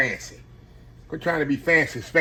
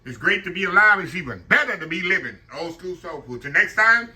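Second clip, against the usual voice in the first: cheery outro; 6 semitones higher than usual. Acted. Upbeat channel outro read.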